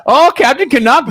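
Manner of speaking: high, loud hammed-up Canadian accent. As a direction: gush like a Canadian fan. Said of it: "can you do the Canadian accent".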